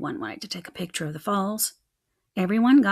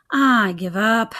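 screech; 6 dB louder, slower than usual. A narrator's reading. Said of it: "I screeched".

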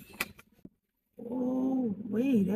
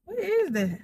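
ordinary talk

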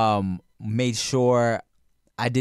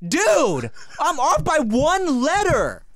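high, drawn-out exasperation; exasperated protest; 12 semitones higher, much slower than usual. Retold or imitated. Son to parents who finally understood, exasperated. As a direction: protest slowly, pitch way up.

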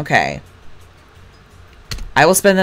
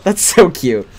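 normal talking voice

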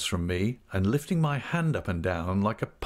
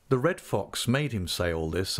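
ordinary delivery

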